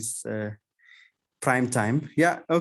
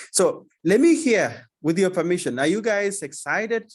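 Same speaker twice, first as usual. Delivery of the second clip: rallying excitement; 6 semitones higher, 4 dB louder than usual. Real, not acted. Host rallying the audience.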